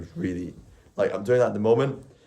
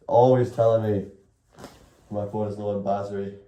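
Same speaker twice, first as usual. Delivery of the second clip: ordinary talk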